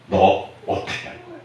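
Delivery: soft unvoiced whisper as God speaking. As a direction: hush it like God asking gently. God's voice quoted softly. (속삭이듯 하나님의 음성으로)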